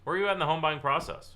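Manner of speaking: high, pushy salesman pitch; mock salesy probing; salesy question. Shown as a demonstration. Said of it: "What does that sound like?".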